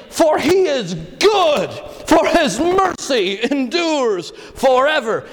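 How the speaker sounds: full-voiced triumphant praise; joyful shout. Shown as a demonstration. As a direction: shout it out like a touchdown cheer. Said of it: "these guys were saying".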